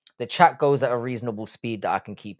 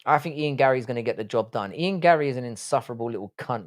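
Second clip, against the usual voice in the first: normal talking voice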